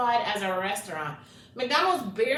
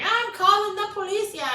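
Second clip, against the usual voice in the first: mock granny voice; 11 semitones higher than usual. Granny threatening to call police.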